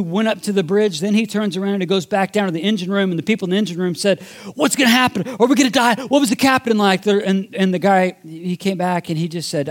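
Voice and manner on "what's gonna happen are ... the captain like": panicked crew. Retold or imitated. Panicked crew questioning returning sailor.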